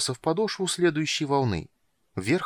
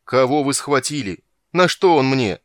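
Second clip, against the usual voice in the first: loud, raised-pitch angry questions; choking with anger; 4 semitones higher, 8 dB louder than usual. A narrator's reading. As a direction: demand it like a furious king. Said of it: "захлебнулся от злости". (злобно, с возмущением)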